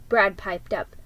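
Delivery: ordinary delivery, nothing marked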